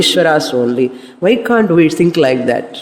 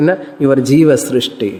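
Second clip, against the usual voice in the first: usual speaking voice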